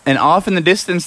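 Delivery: ordinary delivery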